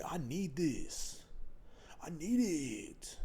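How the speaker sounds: slow, hushed, hypnotized craving; brainwashed consumer. Retold or imitated. Comic mimicking a consumer hooked by media ads, slow and hushed. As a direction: drone it like a zombie shopper.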